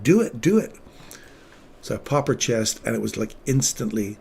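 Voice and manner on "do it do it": high, loud, urgent "do it"; a surgeon's retold urgent shout; urgent go-ahead. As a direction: push them to act now, louder. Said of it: "And they're like".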